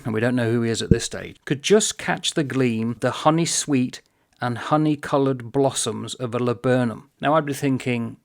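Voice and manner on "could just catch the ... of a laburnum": measured, savouring read-aloud; deliberate, phrase by phrase; slow reading. Shown as a demonstration. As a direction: dwell on each image as you read. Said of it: "but slowly".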